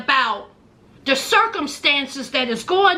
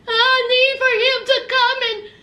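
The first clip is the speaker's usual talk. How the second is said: mock crying wail; 5 semitones higher than usual. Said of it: "kept talking about".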